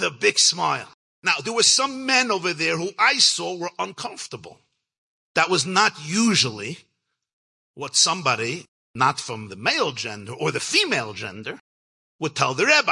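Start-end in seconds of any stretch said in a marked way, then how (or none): none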